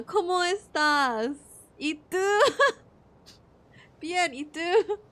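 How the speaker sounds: put-on high, slow playful English-accented Spanish; mock textbook Spanish. Gamer recites leftover classroom Spanish, high and playful. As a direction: recite it like a beginner's drill.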